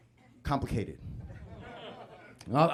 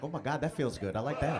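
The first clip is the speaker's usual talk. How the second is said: enjoying the massage; 3 semitones lower, 6 dB quieter than usual. Retold or imitated. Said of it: "I'm like".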